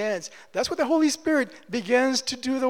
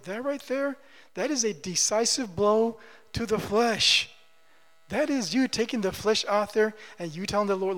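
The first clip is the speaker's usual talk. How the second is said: usual speaking voice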